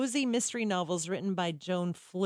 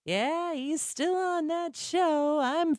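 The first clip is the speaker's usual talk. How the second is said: put-on high, far-off old lady voice; 8 semitones higher than usual. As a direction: imitate the elderly lady at the salon. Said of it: "I don't know why I'm talking like this".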